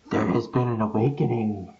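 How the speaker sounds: low, gravelly Batman impression. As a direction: growl it like Batman. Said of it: "you sounded like, uh, that one Batman video".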